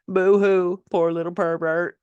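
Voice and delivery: fake-pity sneer at him; mock sympathy. Real, not acted. Author mocking her abusive father with sarcastic pity.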